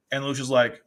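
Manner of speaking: ordinary delivery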